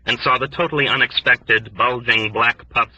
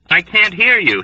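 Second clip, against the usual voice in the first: shouting over noise; 8 semitones higher, 6 dB louder than usual. A narrator's reading.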